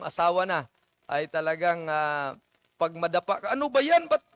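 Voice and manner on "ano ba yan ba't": higher, irritated mock scolding; mock scolding husband. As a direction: nag the wife for tripping. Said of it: "mga ganun-ganun ang mga asawang lalaki". (mock na pagsisermon ng asawang lalaki)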